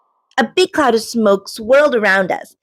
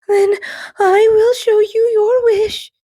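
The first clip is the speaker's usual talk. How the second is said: slow, trembling nervous reply; shaky voice; 11 semitones higher, slower than usual. A narrator's reading. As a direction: let the voice shake a little. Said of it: "her voice shaking".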